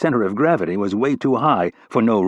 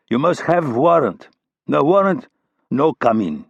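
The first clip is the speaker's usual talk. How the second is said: Russian refusal; 5 semitones higher than usual. A narrator's reading. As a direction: bark it out in a heavy Russian accent. Russian bouncer refusing entry.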